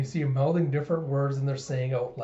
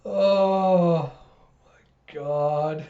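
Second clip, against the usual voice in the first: loud, airy oh my God; real breathless reaction to a laughing fit; overcome with laughter; 3 semitones higher, 6 dB louder, much slower than usual. Real, not acted. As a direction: gasp it out between laughs. Overcome with laughter after confession.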